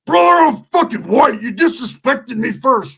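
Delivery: high strained yell, cry in the voice; high pitched outraged yelling; Roach yelling. Retold or imitated. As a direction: yell it like you're getting kicked on the floor. Roach yelling mid brawl.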